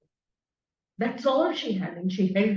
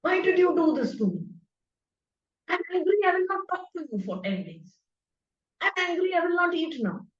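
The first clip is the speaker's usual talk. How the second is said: higher, mock-angry lover's quarrel; sulking at Krishna; 6 semitones higher than usual. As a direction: pretend to sulk and refuse to eat. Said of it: "You can only fight with Krishna".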